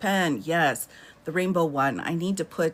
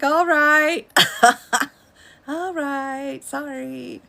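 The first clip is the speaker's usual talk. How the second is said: high, sheepish "All right, sorry"; sheepish backing down; 8 semitones higher, 4 dB louder, much slower than usual. Retold or imitated. Yielding after her husband complains about her yelling, mock sheepish. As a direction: back off like you got caught out. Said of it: "I'm like".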